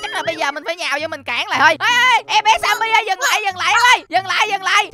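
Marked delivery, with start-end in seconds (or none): 1.9-4.9 raised, louder urgent plea to stop; higher-pitched, pressing shout; urgent calling out. Acted. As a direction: call out to break up a fight.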